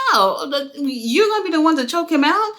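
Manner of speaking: incredulous reenacted question; retold disbelief. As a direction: replay your surprise, pitch climbing into the question. Retells own shock at the choke.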